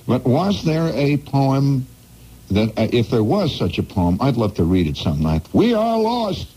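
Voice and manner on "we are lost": much higher, dramatic mock cry; hammed-up captain. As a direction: play the doomed captain, lifted high. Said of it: "the captain shouted".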